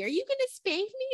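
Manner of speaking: put-on high, cheerful child asking; happy kid voice. Mother imitating her son asking happily. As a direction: mimic the little boy asking gleefully. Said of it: "asking all happily".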